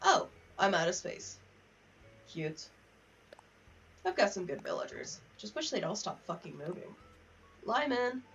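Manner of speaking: normal talking voice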